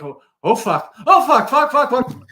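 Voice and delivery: panicked cursing. Retold or imitated. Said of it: "I was like".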